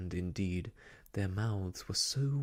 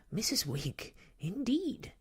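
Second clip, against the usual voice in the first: very high, huffy muttering to herself; thin, scandalised high voice; high, thin, outraged; 12 semitones higher than usual. A narrator's reading. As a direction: squeak with indignation, climbing on the last word. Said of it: "in a high, thin, outraged voice".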